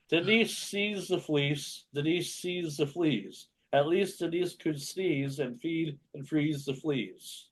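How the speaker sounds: slow, deliberate tongue twister; slow careful reading. Shown as a demonstration. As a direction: read it out slowly. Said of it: "How about this one".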